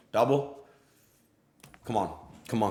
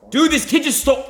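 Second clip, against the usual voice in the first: very high, loud indignant yell; outraged shriek; 12 dB louder than usual. Real, not acted. Streamer shrieks at chat that the kid stole his card. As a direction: explode in disbelief.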